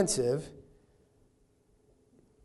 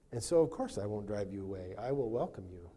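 soft promise; 5 semitones lower, 7 dB quieter, faster than usual. Acted. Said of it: "he's saying".

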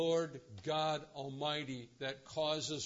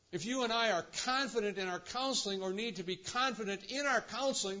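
high, loud, rising sermon climax; fervent pulpit preaching at top pitch; full-force preaching; 9 semitones higher, 6 dB louder than usual. Acted. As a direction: preach it out to the whole room.

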